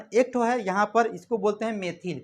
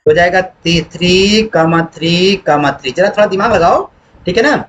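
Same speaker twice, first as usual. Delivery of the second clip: loud urging; 14 dB louder than usual. Real, not acted. Teacher pushes students to think.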